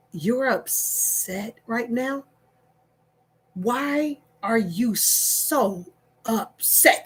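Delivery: slow, soft questions; soothing. Retold or imitated. Wife gently calming husband. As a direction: ease him down slowly.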